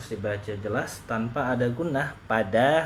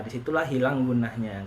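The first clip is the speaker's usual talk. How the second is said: ordinary delivery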